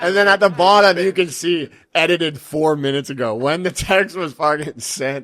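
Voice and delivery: gleeful storyteller hitting the payoff; excited punchline. Real, not acted. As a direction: deliver the punchline like you can't believe it. Reaching punchline of prank story.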